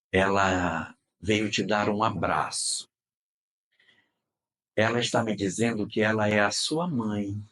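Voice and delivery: retold hushed revelation, measured and low; gentle, tender message. Retold medium gently delivers mother's message.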